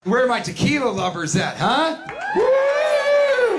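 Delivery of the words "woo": party cheer woo; loud high woo. Real, not acted. Comedian whooping at tequila fans, loud cheer.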